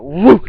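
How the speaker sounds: loud, rising "woo"; imitated whoosh. Telling how a man flipped, loud imitated whoosh. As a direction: whoop out the motion of a flip. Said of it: "I went".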